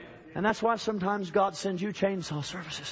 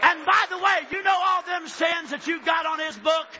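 shouting preacher; 8 semitones higher than usual. Acted. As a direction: hit the peak of the sermon.

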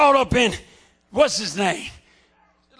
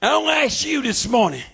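ordinary delivery

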